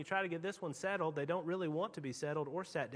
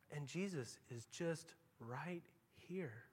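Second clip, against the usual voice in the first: much softer and slow; hushed; 5 semitones lower, 10 dB quieter, slower than usual. Acted. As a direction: drop to a near hush. Preacher softens dramatically.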